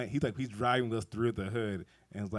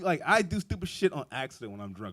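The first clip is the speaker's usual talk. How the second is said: ordinary delivery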